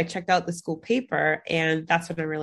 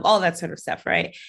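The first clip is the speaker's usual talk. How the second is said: ordinary talk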